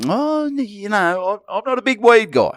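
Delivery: pretending to be innocent; mock coy. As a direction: play innocent, up high. Coy disclaimer in raised voice.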